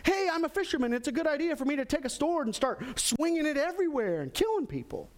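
high, quick mock bravado; impulsive angry Peter. Peter decides to swing a sword, comic impulsive outburst. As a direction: rattle it off high, dropping on the last word. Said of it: "Peter got angry. His initial response".

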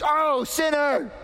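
high, slow, scandalized cry; mock scandalized gasp. Crowd gasping at the tax collector, mock horror. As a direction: gasp it like a shocked gossip.

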